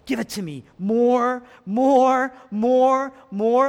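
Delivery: high, drawn-out shouts; loud, insistent demand. Acted. Preacher voices Jesus taking the wrath, loud insistent demand. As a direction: call for it as if welcoming each blow.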